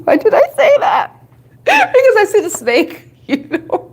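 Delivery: high-pitched giggling through words; laughing. Real, not acted. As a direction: laugh it out, embarrassed. Laughing at her own slip.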